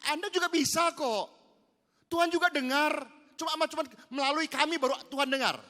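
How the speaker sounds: loud, high, slowed preaching; preaching at full force. Acted. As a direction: press the point home to the congregation.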